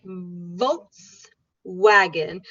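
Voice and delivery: Volkswagen, very slow: bite for V, kiss for W; very slow model. Shown as a demonstration. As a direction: stretch every sound out. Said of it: "first you bite your tongue".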